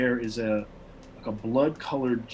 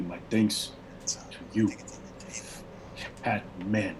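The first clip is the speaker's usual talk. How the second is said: lightly voiced barbarian, halting and slow in places; broken common; 4 semitones lower, 6 dB quieter, much slower than usual. Barbarian leader thanking the cat man, halting broken speech. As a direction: search for each word in a foreign tongue. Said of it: "talks in a broken common".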